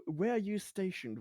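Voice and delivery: ordinary delivery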